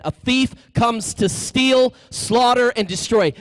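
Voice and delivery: slow, loud, drawn-out scripture reading; emphatic scripture. Acted. Priest reading scripture to the crowd, slow and loud. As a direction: proclaim each verb slowly and loudly. Preacher quoting John ten ten again.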